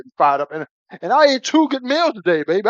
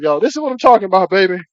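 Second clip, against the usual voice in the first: usual speaking voice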